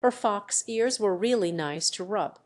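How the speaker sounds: ordinary delivery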